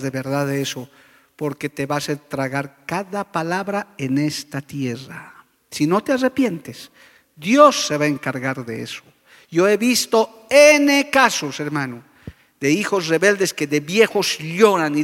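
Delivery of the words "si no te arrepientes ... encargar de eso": ordinary delivery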